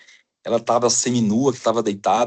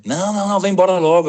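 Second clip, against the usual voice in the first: higher, insistent boss voice on the phone; impatient boss; 8 semitones higher, 3 dB louder, faster than usual. Retold or imitated. Boss on the phone demanding he come back now, higher and insistent. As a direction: hurry him back like an annoyed boss.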